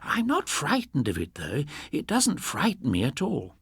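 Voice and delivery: put-on a calm old woman unbothered by ghosts; old lady. A narrator's reading. Old lady telling the policemen she isn't scared, lightly voiced. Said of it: "The old lady nodded".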